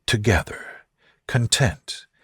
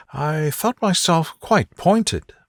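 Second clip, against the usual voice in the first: put-on a slightly higher, slow, teasing young lady; Elizabeth, lightly voiced; 9 semitones higher than usual. A narrator's reading. Elizabeth teasing Darcy about her letter, a lightly voiced young lady.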